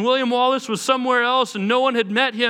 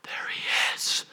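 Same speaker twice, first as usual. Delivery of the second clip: slow, hushed whisper; awed whisper; 8 dB quieter, slower than usual. Retold or imitated. Friends spotting the famous uncle, awed whisper. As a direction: whisper it in mock awe.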